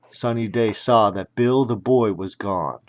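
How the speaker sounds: usual speaking voice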